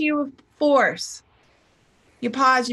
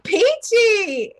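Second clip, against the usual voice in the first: high, loud sing-out of "Peachy"; perky peachy; 10 semitones higher, 4 dB louder than usual. Retold or imitated. Coach imitating the teller's answer to how are you, perky. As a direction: chirp it with exaggerated cheer. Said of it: "or something like".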